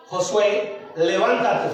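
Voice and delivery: low, firm voice of the Lord; God's command. Retold or imitated. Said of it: "Y el Señor le dice". (orden divina, voz grave)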